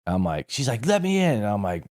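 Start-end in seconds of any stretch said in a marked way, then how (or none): 0.8-1.4 higher, insistent imitation of a yelling woman; mock her pushing at the door; angry wife at the door, demanding. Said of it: "she's yelling and screaming".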